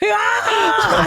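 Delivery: high shriek of laughter; laughing shriek. Real, not acted. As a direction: let out a cackling cry.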